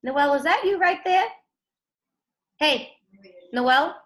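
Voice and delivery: high, loud surprised call of a name; teacher surprised to spot Noel. Real, not acted. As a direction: call out to him as you finally spot him. Spotting a student on camera.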